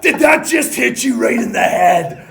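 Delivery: loud, high, alarmed shout; mock shout. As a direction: yell it across the bench in alarm. Teammate shouting on bench.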